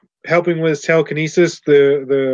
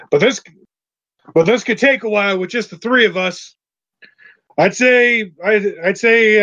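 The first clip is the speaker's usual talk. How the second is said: higher-pitched working character voice; Mister Bulger; 5 semitones higher than usual. Acted. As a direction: explain it as the one running the job.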